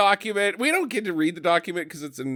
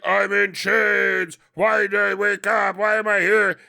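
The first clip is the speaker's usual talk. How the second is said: waking troll voice; 3 semitones higher, 4 dB louder than usual. Retold or imitated. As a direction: imitate a troll waking up bewildered. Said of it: "And it's like".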